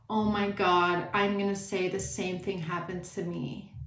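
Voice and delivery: lightly mock the attention-seeking girl; a hint of a copycat girl claiming the story. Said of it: "girl sitting around going".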